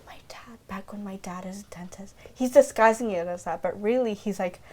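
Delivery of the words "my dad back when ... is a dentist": hushed haunted whisper; mock whisper. [name] whispering a traumatic flashback to himself, hushed. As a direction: breathe it out like a dark secret.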